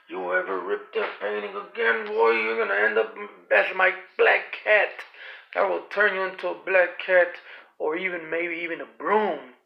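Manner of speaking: lightly voiced menacing witch; a hint of a witch threatening; mild witch threat. A narrator's reading. Rosemary shaking Jack and threatening him, mild menace.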